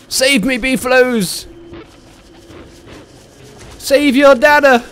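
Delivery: high, loud cry for rescue; shouted plea for help. Real, not acted. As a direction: yell desperately for the herd to save you. Calling game animals for rescue.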